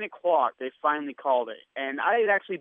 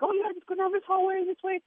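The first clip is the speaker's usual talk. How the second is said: much higher put-on woman's voice; helpful female guard. Security guard directing a lost employee, high mimicked helpfulness. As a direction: imitate her in a high, obliging voice. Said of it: "And she's like".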